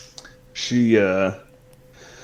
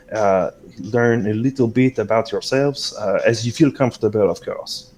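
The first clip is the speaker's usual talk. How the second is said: put-on accented, politely apologetic guide; courteous hostess character; 3 semitones higher than usual. Game master as Celeste hurrying the newcomers along, courteous accented guide. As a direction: play an accented, hospitable host.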